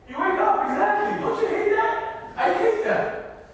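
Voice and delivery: high, loud, drawn-out mock frustration; comic exasperation. Speaker to audience about waking mid-dream, comic exasperation. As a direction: cry it out as a hammed-up complaint.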